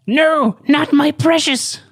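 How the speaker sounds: very high, slow wailing creature; Gollum scream. Acted. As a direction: shriek like a creature losing its treasure. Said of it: "[name] screamed".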